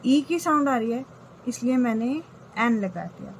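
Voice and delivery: usual speaking voice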